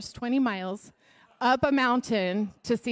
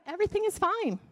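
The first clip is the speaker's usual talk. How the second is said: high, lilting reassurance; woman's assurance; 10 semitones higher, faster than usual. Acted. Woman telling Gehazi all is fine, high and lilting. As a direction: lift it well above normal pitch. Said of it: "the woman told Gehazi".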